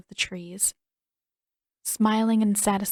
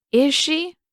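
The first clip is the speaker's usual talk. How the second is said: louder, suspicious woman; cold mother; 8 semitones higher, 6 dB louder than usual. A narrator's reading. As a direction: doubt it coldly. Mother coldly questioning.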